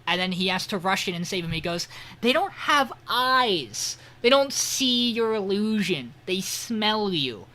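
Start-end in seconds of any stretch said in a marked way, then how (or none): 2.2-7.4 higher, very slow, spelling it out; slow, pointed lecture to a panicking mage; slow urgent explaining. Retold or imitated. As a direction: lay it out word by word for a slow partner. Will explaining urgently mid-fight.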